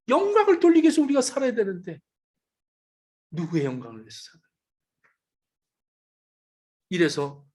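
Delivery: ordinary delivery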